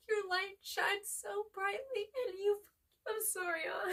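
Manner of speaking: tearful, high and slow; real crying while reading. Real, not acted. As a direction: speak through real tears. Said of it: "I'm getting really emotional".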